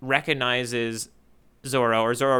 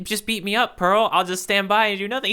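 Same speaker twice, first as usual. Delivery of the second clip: raised pitch, lightly put-on villain voice; mock Zeph; 6 semitones higher, 3 dB louder than usual. Goofing on Zeph for a co-host, higher mock voice. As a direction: play a smug Zeph begging to be hit, pitched up. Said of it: "he's like".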